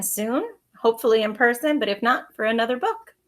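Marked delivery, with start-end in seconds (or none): none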